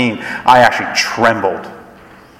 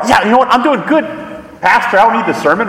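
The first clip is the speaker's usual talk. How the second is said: higher and fast, smug and breezy; breezy overconfidence; 8 semitones higher, much faster than usual. Pretend. Churchgoer brushing off the pastor, breezy and fast. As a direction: toss it off like you don't need church. Mocking a self-assured churchgoer.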